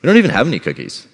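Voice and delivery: lightly acted feigned ignorance; teasing dad. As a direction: play dumb to tease the kids. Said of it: "'cause I'm a dad, we love dad jokes".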